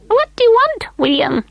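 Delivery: very high, piping call; high little elf calling. A narrator's reading. Mister Munty voiced.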